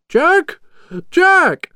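loud, very high call; high, urgent shout of a name. A narrator's reading. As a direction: call for someone down a hole. Someone calls down to Jack.

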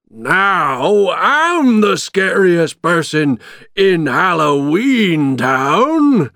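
slow, loud, high gloating boast; happy shout. A narrator's reading. Villain boasting to his captives, happy gloating shout. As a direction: crow it out, stretching every word. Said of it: "he shouted happily".

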